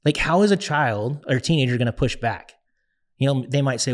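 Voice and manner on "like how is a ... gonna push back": usual speaking voice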